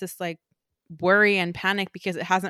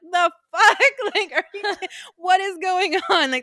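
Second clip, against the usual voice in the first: high, loud disbelieving indignation; incredulous outburst; exasperated outrage; 9 semitones higher, 4 dB louder than usual. Acted. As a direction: demand what is going on. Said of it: "And Cersei is like".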